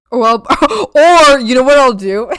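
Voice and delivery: laughing loudly mid-sentence; loud laughter through the words. Real, not acted.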